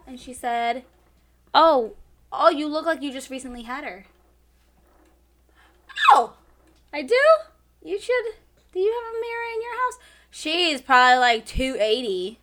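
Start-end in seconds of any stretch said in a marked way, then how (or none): 1.5-10.0 mock coworker voice. Retold coworker comment and retort.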